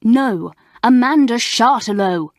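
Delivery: 'Chartelot' said firmly and loudly, correct English; loud, contemptuous correction of the name. Acted. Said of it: "she said in a loud voice".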